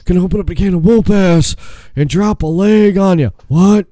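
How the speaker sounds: loud, high wrestler promo; Stone Cold Steve Austin. Acted. Co-host threatening an opponent as Stone Cold, loud and cocky. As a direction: belt it out like a trash-talking wrestler.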